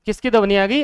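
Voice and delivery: ordinary delivery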